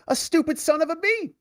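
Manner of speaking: high, squeaky mocking Biden jab; mock Biden insult. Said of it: "He called Peter Doocy".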